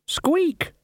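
tiny high-pitched squeak; high rat squeak. A narrator's reading.

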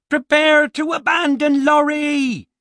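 high, drawn-out shouted command. Acted. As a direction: shout it like a captain's order, pitched right up high. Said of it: "he shouted".

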